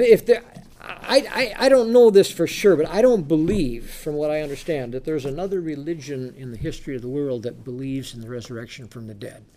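ordinary delivery

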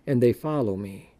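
booming scripture reading. Acted. Reads scripture aloud.

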